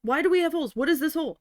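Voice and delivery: imitating an irate manager shouting; mock angry manager. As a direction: play the furious boss yelling. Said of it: "flipping out at me".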